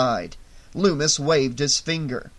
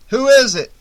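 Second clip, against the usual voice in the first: loud, higher demand into the radio; urgent sheriff calling over static; 9 semitones higher, 11 dB louder than usual. A narrator's reading. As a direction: bark the question at the mic.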